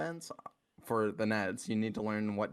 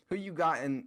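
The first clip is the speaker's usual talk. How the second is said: normal talking voice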